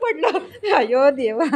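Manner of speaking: slow words through laughter; laughing. Real, not acted. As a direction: exclaim while still laughing, drawn out. Laughing at the fall.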